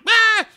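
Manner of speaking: very high short shriek; a sudden high yelp; panicked shriek. Retold or imitated. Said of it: "Crystal's like".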